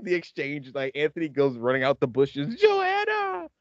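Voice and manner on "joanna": high mock shout of a name; calling out. Anthony yelling for Joanna as she's taken away, high mock call. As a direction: yell after her from the bushes.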